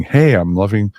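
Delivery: usual speaking voice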